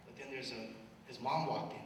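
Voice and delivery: usual speaking voice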